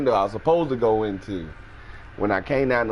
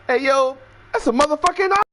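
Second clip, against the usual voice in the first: very high, louder alarm; a very high, louder alarmed shout; alarmed roommate; 4 dB louder, faster than usual. Retold or imitated. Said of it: "you hear again is".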